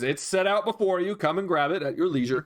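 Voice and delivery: a lightly voiced innkeeper welcoming his guest; innkeeper's voice. To the guest asking for breakfast, a lightly voiced friendly innkeeper. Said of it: "He says".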